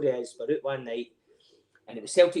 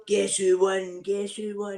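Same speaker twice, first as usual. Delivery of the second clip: gloating; 7 semitones higher, 4 dB louder, much slower than usual. Retold or imitated. Hobbo waving his winnings.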